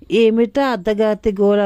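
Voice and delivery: plain reading voice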